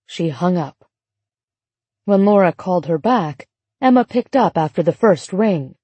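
ordinary delivery, nothing marked

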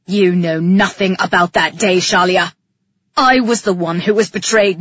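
low, forceful male character voice; wronged man's heated outburst; angry Calyx. A narrator's reading.

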